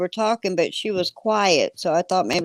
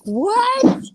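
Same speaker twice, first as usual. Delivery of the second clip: startled what; 4 dB louder than usual. Retold or imitated. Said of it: "I'm like".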